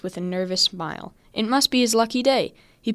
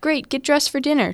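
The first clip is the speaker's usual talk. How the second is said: higher, louder mother's call; mom calling from kitchen; 7 semitones higher, 4 dB louder than usual. Acted.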